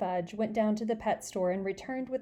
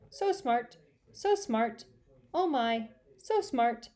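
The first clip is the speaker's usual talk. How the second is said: bird voice; 3 semitones higher, slower than usual. Acted. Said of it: "Uncle Feather said".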